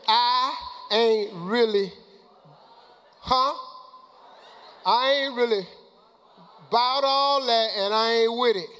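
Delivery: "I ain't really" stretched slow and loud. Shown as a demonstration. Obliging the coach who asked for it slowly, loud and slow. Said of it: "Could you say that for me slowly".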